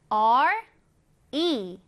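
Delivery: ordinary talk, nothing marked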